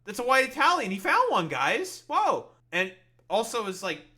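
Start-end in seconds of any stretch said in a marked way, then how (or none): 0.0-2.5 loud, high mock astonishment; surprised. Real, not acted. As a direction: shout it like a stunning discovery.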